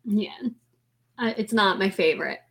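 ordinary talk, nothing marked

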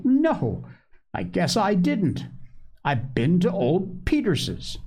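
put-on high-pitched indignant child's denial. A narrator's reading. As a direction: protest it in a high, affronted voice. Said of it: "reported Joe indignantly".

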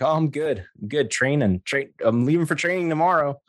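eager. Retold or imitated. His eager reply, raised pitch.

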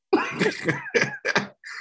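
a high, drawn-out laugh that falls away; long high laugh. Real, not acted. Host laughing at his own quip about getting professional. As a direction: crack up at your own joke.

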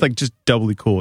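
ordinary talk

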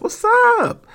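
a sudden squeal way up high on what's up; a loud high hype-up welcome for a newcomer; excited greeting. Real, not acted. Host greeting new listeners with a high excited yelp.

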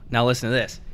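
normal talking voice

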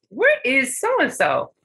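high, slow puzzled call; mock teacher calling out for the missing boy; teacher asking. Teacher calls out to the class, high and slow.

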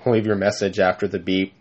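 low, flat answering-machine robot. Acted. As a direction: drone it like a dying robot. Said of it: "I sounded like a dying robot".

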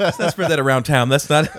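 high, loud, through laughter; laughing plea. Retold or imitated. Friend asking him to keep it secret, laughing. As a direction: laugh helplessly while imitating an embarrassed plea.

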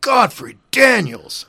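loud, high, drawn-out exclamation; comedian's mock curse. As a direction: bellow a stand-in swear word slowly. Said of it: "he would say stuff like".

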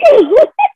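high-pitched squealing giggle; high squeal laugh. Real, not acted. Laughing at own quote.

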